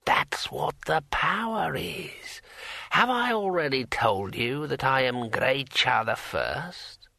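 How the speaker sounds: slow, whispery ancient voice, then fuller; a long-dead old man pronouncing at leisure; drawn-out old voice, starting near a whisper; brittle old voice. A narrator's reading. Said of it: "in a brittle old voice".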